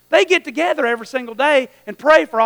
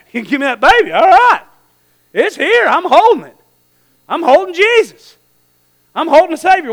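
excited, higher-pitched exclamations; thrilled to be holding the baby, in short bursts; fired-up old Simeon; 4 semitones higher, 4 dB louder than usual. Acted. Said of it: "He, he is excited".

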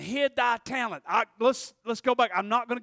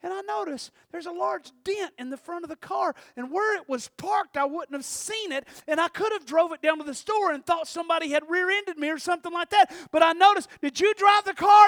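higher mom voice building to a loud accusation; suspicious mother, getting louder; mom questioning; 7 semitones higher, 3 dB louder than usual. Retold or imitated. Mother on the phone questioning her son about the dent.

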